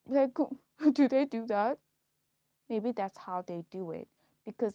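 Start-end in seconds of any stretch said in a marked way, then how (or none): 0.1-1.8 laughing. Real, not acted. Laughs in disbelief.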